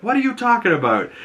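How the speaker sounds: high, fast, exasperated; confused reply. Retold or imitated. Harcourt answering Peacemaker, high, fast and irritated. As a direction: snap it back quick and high. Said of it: "And she's like".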